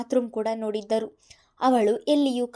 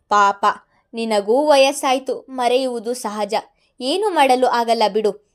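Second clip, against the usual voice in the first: louder, mocking villain on the phone; taunting villain; 8 dB louder than usual. A narrator's reading. Madan taunting Mithun over the phone, loud and mocking. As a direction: needle him with each word.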